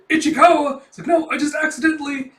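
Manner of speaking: hammed-up surprise; startled outcry. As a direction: blurt out the name in shock. Kafka startled, flustered.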